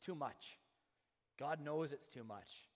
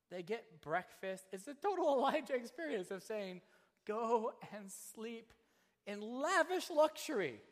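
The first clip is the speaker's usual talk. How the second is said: loud, higher, slowed joyful build; excited preaching; 9 semitones higher, 10 dB louder than usual. Real, not acted. Preacher exulting over God's provision. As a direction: revel in the luxury.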